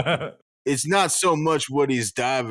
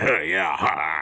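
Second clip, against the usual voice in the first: Malcolm impression; 9 semitones lower, slower than usual. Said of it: "That's exactly how he would respond".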